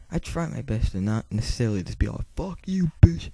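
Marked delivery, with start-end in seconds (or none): 2.3-3.3 high-pitched mock insult, not loud; mock outburst. Acting out the insult he tries not to say, mock outburst. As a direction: fling a high, hammed-up insult. Acted insult he avoids saying.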